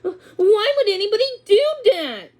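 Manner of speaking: high, loud, near tears; shocked disbelief. A narrator's reading. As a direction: cry out in disbelief. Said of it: "sounding shocked".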